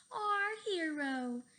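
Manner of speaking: loud, very slow cheer; shouting. A narrator's reading. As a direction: cheer it out slowly. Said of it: "shouted the boys".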